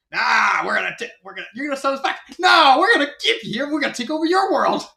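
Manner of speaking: loud, high gloating villain; villain taunt. Retold or imitated. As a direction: boom it out like a cartoon villain. Koopa taunting the Koopalings.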